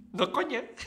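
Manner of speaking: can't stop laughing while insisting; giggling disbelief. Real, not acted. Laughing at the Spain map.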